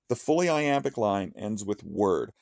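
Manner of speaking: ordinary talk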